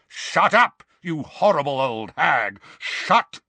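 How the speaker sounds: man roaring at the screaming portrait. A narrator's reading. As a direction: bellow her down. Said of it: "he roared".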